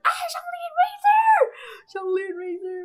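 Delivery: piercingly high squeal, easing off on the repeat; reenacted joyful scream; fangirl screaming. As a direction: shriek both names in delight. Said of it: "I think I scream about Zhongli so much".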